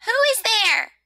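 high, loud demand; indignant rosebud. Acted. Rosebud to the tapping visitor, indignant demand.

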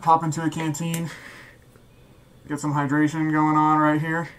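muffled voice inside a gas mask; through a gas mask. Real, not acted. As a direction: talk with the mask sealed over your face. Demo talking while wearing mask.

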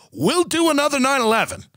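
high, blunt mock confession; mock TV host. Imagined TV host admitting the plan to viewers, high mock confession. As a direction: pitch up and spill the secret plainly. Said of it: "Look at the host of C N B C and go".

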